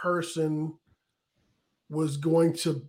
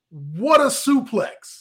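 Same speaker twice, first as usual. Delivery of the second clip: announcer voice; 8 semitones higher, 6 dB louder, much slower than usual. Retold or imitated. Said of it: "Michael Cole said".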